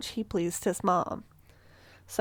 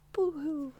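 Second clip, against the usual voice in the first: mock boo-hoo; 7 semitones higher, 5 dB quieter than usual. Mocking the killer's resentment.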